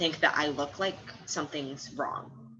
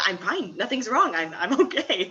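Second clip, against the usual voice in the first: mildly flustered insistence; defensive reassurance; 4 semitones higher, 4 dB louder than usual. Retold or imitated. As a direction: insist lightly that all is well, stumbling a little. Acting out her mistaken defensive reply.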